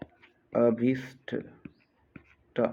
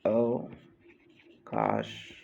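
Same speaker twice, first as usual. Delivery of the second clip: low, slow "अवकाश" with a long gap after अव; split slowly for dictation; 12 semitones lower, 4 dB quieter, much slower than usual. Shown as a demonstration. Teacher dictating a spelling, अवकाश slowly in two parts. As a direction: break "अवकाश" into अव, pause, काश.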